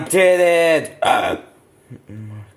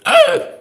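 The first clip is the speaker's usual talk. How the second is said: high hiccup gasp; 6 dB louder than usual. Acted. Luigi hiccups in the skit.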